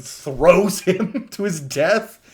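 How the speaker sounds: loud, drawn out through laughter; amused. Real, not acted. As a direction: laugh while gleefully telling it, slowly. Amused by the villain's death.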